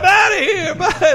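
high, loud breezy exit line; imitating Grandpa. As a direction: chirp it out cheerfully.

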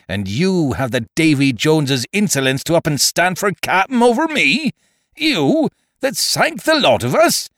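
vehement. A narrator's reading. Silver turning on his challenger for captain, vehement outrage. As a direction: pitch soaring in outraged disbelief. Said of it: "talking with a vehemence that shook the house".

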